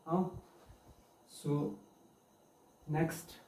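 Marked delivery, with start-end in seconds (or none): none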